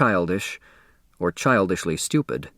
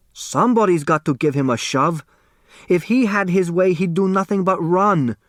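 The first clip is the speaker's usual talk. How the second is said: stern father; 8 semitones higher than usual. Acted. Hu defends scolding his son.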